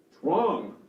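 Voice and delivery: mildly scolding verdict; judgmental parent. Acted. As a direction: lightly play a parent passing judgment. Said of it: "directive, judgmental".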